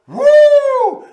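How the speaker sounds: high, loud whoop of delight; excited whoop. Real, not acted. Food host whooping over sweet corn, loud and high.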